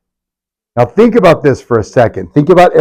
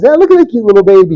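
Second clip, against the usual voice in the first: put-on fast, higher, cutesy baby coo; 8 semitones higher, 3 dB louder, faster than usual. Stranger grabbing a baby, fast cutesy coo. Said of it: "and went like this".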